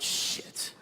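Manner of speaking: breathy whispered curse; a scared night-time whisper. Acted. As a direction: hiss it under your breath. Panicked late-night whisper.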